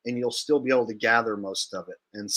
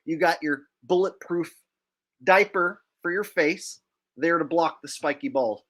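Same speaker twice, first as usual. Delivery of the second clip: ordinary talk, nothing marked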